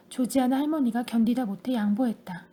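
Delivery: plain reading voice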